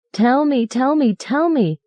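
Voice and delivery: high, insistent shouts; girl shouting at the driver. Acted. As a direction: demand it over and over, high. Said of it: "Janie was shouting".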